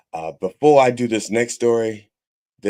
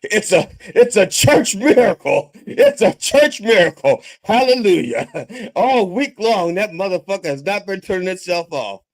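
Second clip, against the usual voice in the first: shouting hallelujah over a miracle; real delighted outburst; ecstatic; 10 semitones higher, 7 dB louder than usual. Real, not acted. Joyful outburst about miracle.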